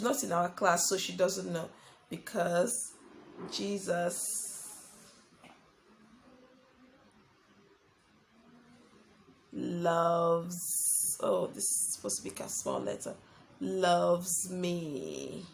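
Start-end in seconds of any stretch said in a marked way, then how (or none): 2.1-11.2 low, soft, drawn-out words; writing-along voice. Real, not acted. Saying words while writing them out. 11.2-13.1 quick whispered aside; muttered self-correction. Real, not acted. Muttered self-correction while writing. 13.6-15.4 low, soft, stretched words; writing-along voice. Real, not acted. Saying words while writing them out.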